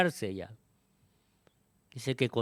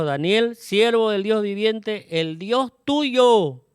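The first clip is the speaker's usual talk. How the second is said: high, slow, loud anguished call; king's high drawn-out call to Daniel; sad calling out; 8 semitones higher, 6 dB louder, slower than usual. A narrator's reading. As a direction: call down into the pit in grief. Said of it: "llamó a voces a Daniel con voz triste".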